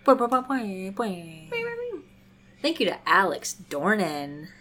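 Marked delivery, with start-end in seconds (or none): 0.1-2.0 playful high bouncing boings; boing sounds. Pretend. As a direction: bounce it like a cartoon spring. Cheering patrons with bounce sounds.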